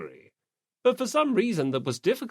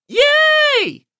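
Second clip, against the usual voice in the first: high, loud squeal of delight; joyful cheer; 13 dB louder than usual. Acted. Asahina cheering at the promise of donuts, loud happy squeal. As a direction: squeal it out like a kid.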